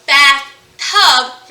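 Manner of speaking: BATH and TUB slow and loud, split apart; slow two-beat bathtub; felt syllables. Shown as a demonstration. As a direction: drop the chin on each half of bathtub. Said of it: "syllables in the word".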